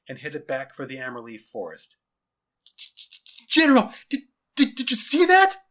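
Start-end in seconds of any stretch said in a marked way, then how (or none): 3.5-5.7 high, loud, stammering amazement; awestruck soldier. Acted. Soldier reporting the sight to his general, awestruck. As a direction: stumble over it in shouted astonishment. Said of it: "said an awestruck soldier".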